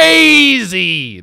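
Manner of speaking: loud, high, drawn-out disbelief; incredulous exclamation. Real, not acted. Host reacting to the refunded, cancelled game, incredulous. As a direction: stretch it out high and loud, astounded.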